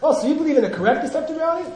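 fast, high, incredulous question; debate challenge. Acted. Debate opponent challenging a student, fast and high. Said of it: "Somebody says something to you in the debate ground".

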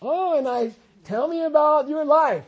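put-on high, sing-song talking down to an old person; condescending. Speaker mocking how people talk down to the elderly, condescending. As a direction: patronize them like a small child.

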